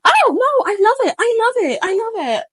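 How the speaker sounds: very high, squeaky flustered backpedal; mock flustered. A caught friend backpedaling in a panic, squeaking very high. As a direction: squeak it out like someone caught lying.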